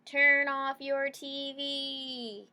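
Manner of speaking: drawn-out, emphatic reading of the sign; slow sign reading. Acted. Teacher reading the dog's sign to the class, slow and drawn out. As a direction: spell out the message slowly for the kids.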